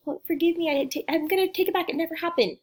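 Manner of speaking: high, hurried mock pleading; frantic take-back. Someone trying to take back hurtful words, high mock pleading. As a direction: act out scrambling to undo a slip.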